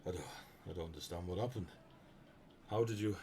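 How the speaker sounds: halting, mildly dazed, trailing off; understated bewilderment with long pauses; stunned disbelief. Acted. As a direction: falter as if you can't grasp your defeat. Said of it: "He's just staring at his hand".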